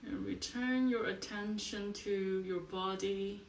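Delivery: soft meditation voice with long pauses; guided meditation. Acted. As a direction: guide them quietly into the body, leaving space between phrases.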